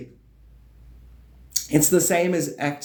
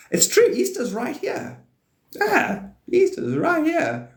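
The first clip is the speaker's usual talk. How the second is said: way up high, goofy and delighted; giddy high-pitched announcement; high silly squeal; slower than usual. Real, not acted. Silly high excited exclaim.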